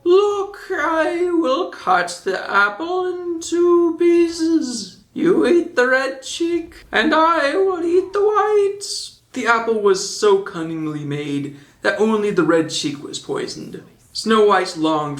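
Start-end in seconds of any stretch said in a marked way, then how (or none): 0.0-8.8 put-on high, slow old peddler woman; old witch voice. A narrator's reading. Old woman cuts the apple. 13.0-13.8 hushed whisper; whispering the sinister twist. A narrator's reading. Narration of the poisoned apple.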